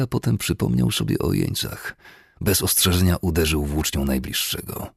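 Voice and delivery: ordinary delivery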